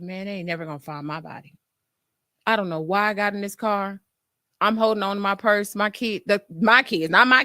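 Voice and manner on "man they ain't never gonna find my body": soft, fast, fearful mutter; a soft, quick aside in mock dread. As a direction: mutter it under your breath in dread. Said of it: "I said".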